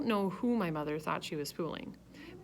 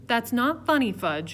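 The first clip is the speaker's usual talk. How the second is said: louder, sharper reprimand; father scolds his son after the mask scare, louder; stern scolding; 5 semitones higher, 8 dB louder than usual. A narrator's reading. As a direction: cut the joke short.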